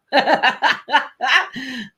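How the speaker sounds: long real laugh; helpless giggling run. Real, not acted. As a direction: laugh it out at length. Amused at own accent.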